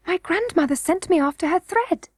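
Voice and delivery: high little-princess voice; young princess Irene, earnest. A narrator's reading. Princess explains to Curdie how she got here, high-voiced.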